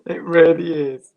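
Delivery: giggling agreement; laughing. Real, not acted. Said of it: "You made me laugh".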